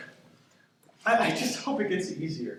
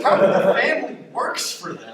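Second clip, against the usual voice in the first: laughing; 9 semitones higher, 8 dB louder than usual. Real, not acted. Laughing with the other person.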